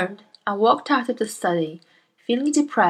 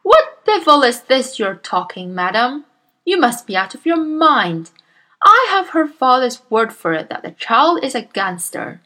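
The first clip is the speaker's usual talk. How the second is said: loud, booming bully of a headmistress; Miss Trunchbull; 5 semitones higher, 6 dB louder than usual. Acted. Bullying headmistress scolds Miss Honey, booming and loud. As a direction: bark at the timid teacher like a tyrant.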